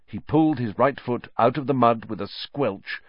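ordinary delivery

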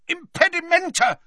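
high, urgent cry of a spell; yelled spell. A narrator's reading. Boy casting a jinx at his pursuers, yelling. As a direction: yell out a curse mid-fight. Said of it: "he yelled as he rolled over again".

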